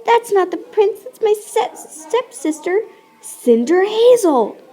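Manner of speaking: high, indignant stepsister voice. Acted. Stepsister unmasks Cinder Hazel, high and indignant. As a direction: squeal it like a snooty stepsister.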